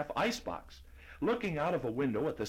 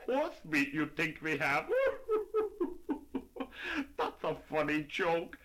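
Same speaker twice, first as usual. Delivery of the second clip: laughing shopkeeper; 4 semitones higher than usual. Acted. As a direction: chuckle through the words.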